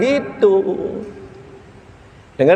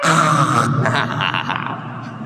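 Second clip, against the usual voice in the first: laugh; 5 semitones lower, 3 dB quieter than usual. Real, not acted. Tertawa setelah meniru istri.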